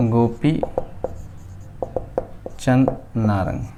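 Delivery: usual speaking voice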